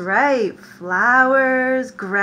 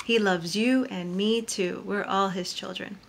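ordinary delivery, nothing marked

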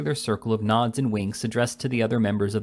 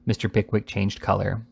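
ordinary delivery

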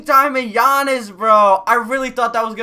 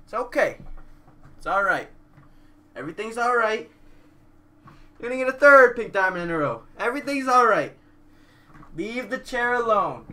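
much softer, hushed self-soothing; mock calming; 4 semitones lower, 6 dB quieter, faster than usual. Streamer soothing himself after a bad pull, soft mock calm. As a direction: talk yourself down like calming a friend.

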